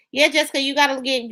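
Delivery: usual speaking voice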